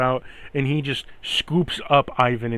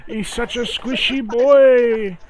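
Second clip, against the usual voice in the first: put-on higher, slow gushing baby talk; doting baby talk; 10 semitones higher, 7 dB louder, slower than usual. NPC gushes at the cat in doting baby talk. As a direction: gush over the squishy cat.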